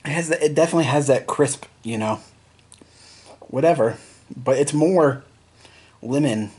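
wet mouth just swallowed; speaking between sips; right after a sip. Real, not acted. Seen 1: Sips from can at -1.0, speaks right after with can held up.

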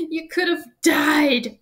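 slow, high, loud acted wail; drawn-out tearful cry; sobbing. A narrator's reading. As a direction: sob it out with horror at yourself. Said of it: "sobbed Hagrid".

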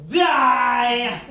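yelling. Shown as a demonstration. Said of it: "needs to yell out".

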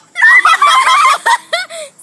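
very high, loud squeal of laughter. Real, not acted. Spider appears.

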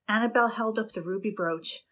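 usual speaking voice

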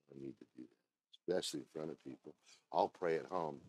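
low, soft mock excuse; lightly voiced shrugging churchgoer; reluctant excuse. Preacher mimicking someone dodging the altar, low. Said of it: "voicing a reluctant churchgoer".

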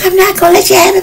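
very high squeaky mock bickering; hammed-up squealing tug-of-war; squabbling over money. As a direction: imitate greedy squabblers in a tiny high voice. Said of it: "They were just fighting".

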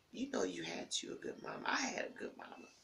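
choked, whispered through tears; a real tearful whisper about her mother. Real, not acted. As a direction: barely get the words out, grieving. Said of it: "I'm having a moment".

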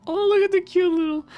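excited squeal. Real, not acted. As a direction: squeal at something adorable. Spots something cute in game.

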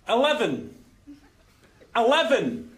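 stubbornly identical repeats of "Eleven"; same way each time. Acted. Man insisting to the lift, saying it the same way. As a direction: repeat it unchanged, with a pause after each. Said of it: "You're just saying it the same way".

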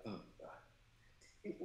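a breathless mock groan under the breath; whispered dread. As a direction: hush out oh God in embarrassment. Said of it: "I'm like".

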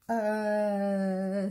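long, level, uneasy "uhhh"; hesitant "uh". A narrator's reading. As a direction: stall with a drawn-out filler. Fox dreads climbing the tree.

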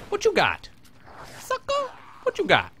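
high, loud trash-talk challenge; mock taunt. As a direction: goad the enemy like a brawler. Taunting the enemy mid-fight.

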